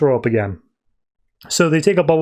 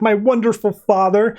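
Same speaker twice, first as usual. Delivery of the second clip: higher mock-proud retort; fake arguing; 6 semitones higher than usual. [name] answers Harley in their fake fight, mock-proud. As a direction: play it as a staged comeback. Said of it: "it's clearly fake".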